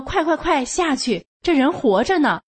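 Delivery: high, urgent retold command; suddenly energized. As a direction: imitate a man springing to life and rallying others. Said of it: "他来精神了".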